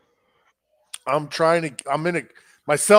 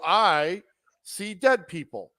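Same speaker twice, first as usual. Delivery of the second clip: slow, drawn out with a pause after "I"; eerie movie-line delivery; 3 semitones higher, slower than usual. Retold or imitated. Lost driver quoting a film line to strangers, slow and eerie. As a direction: let it out slowly, like a creepy confession. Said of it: "I went just like that".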